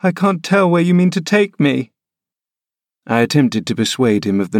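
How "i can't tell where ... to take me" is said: put-on high, stubborn child's cry; a frail boy refusing to budge; petulant boy. Linton refusing to leave without uncle, high petulant boy.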